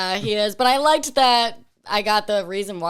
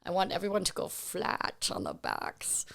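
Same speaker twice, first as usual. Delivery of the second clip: put-on very soft, low muddled foreign accent; hushed mock-Latvian accent; vague accent impression; 5 semitones lower, 11 dB quieter than usual. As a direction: murmur it in an unplaceable, badly faked accent. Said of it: "what accent was that".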